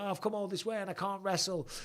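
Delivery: imitating stressed-out son. Dad retelling his son's stress before a tournament, mock fretting. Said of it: "He's stressing out".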